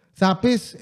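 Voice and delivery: ordinary delivery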